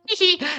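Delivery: high-pitched cartoon giggle; short, high acted titter; inventor's giggle. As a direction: giggle gleefully as a zany inventor.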